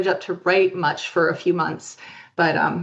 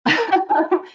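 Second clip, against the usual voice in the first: laughing; 5 semitones higher than usual. Real, not acted. Laughs while telling the story.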